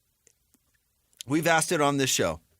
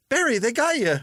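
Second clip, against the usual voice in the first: taunting; 9 semitones higher than usual. Acted. Taunting Barry, high and loud.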